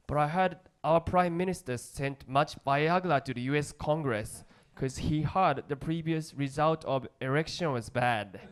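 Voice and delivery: ordinary talk, nothing marked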